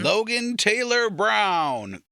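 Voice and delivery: slow, high announcer voice; mock ceremony announcer. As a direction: call the name out slowly like at a graduation. Imitating the graduation announcer.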